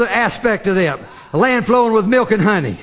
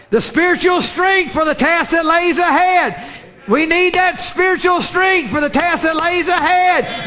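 full-force preaching; 5 semitones higher than usual. Acted. Preacher urging congregation to store food, shouting. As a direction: hammer home the call for spiritual food.

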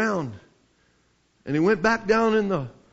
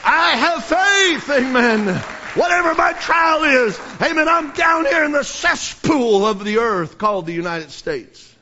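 preaching at full force; 4 semitones higher than usual. Real, not acted. Preacher crying out his faith to the congregation, full force. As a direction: shout it out like a revival preacher.

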